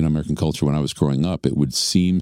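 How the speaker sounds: ordinary delivery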